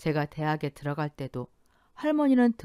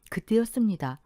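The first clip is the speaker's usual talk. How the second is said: ordinary delivery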